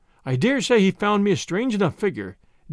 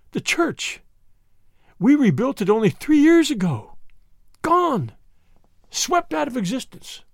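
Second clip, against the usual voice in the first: high, grieving clergyman's wail; distraught curate; 7 semitones higher than usual. Acted. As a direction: mourn the lost church as a broken priest. Curate grieves for his church.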